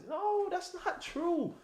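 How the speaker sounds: very high, slow, drawn-out no; scoffing denial. Real, not acted. Scoffing high and slow at a viewer's chat claim. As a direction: stretch it out in disbelief, way up high.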